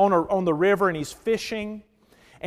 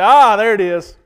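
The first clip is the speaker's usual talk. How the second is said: mock triumphant, louder gloating; passive-aggressive glee; 9 dB louder than usual. Preacher mocking Christians heaping coals on enemies, gloating. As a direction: gloat as if you've got them now. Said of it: "passive-aggressive way of kind of cursing".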